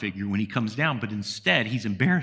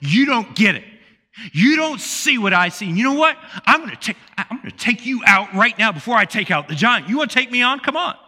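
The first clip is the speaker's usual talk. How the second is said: hammed-up brotherly threat, a bit louder; playing the aggressor, daring him; mock aggressive challenge; 7 semitones higher, 4 dB louder than usual. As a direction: pick a fight, half in jest. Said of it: "the aggressor would have been".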